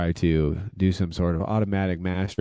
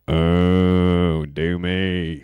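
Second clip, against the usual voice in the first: imitating Steven Schwartz; much slower than usual. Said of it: "this is how Steven Schwartz would say this".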